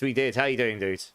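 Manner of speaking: usual speaking voice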